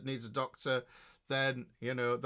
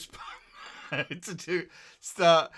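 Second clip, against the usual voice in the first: laughing; 3 dB louder than usual. Real, not acted. Cracks up mid-sentence.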